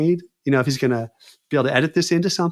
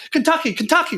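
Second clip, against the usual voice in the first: Kentucky squeaked very high, desperate; terrified victim crying the safe word Kentucky; frantic pleading; 13 semitones higher than usual. Retold or imitated. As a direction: shriek the safe word to make him stop. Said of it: "like this".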